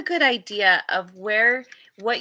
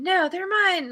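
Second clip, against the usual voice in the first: higher, whiny mock squabble; higher squabbling repeat; 10 semitones higher than usual. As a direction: push it up higher, sing-song and petulant. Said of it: "And it's like".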